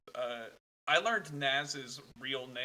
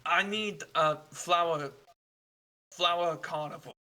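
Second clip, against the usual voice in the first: a hint of a halting German traveler asking directions; polite stranger searching for words; lightly voiced foreign traveler.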